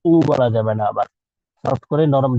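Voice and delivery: ordinary talk, nothing marked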